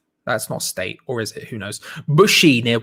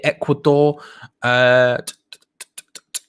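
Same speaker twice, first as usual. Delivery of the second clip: ordinary delivery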